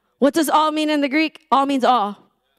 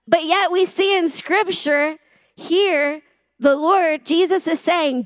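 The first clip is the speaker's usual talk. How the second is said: ordinary talk, nothing marked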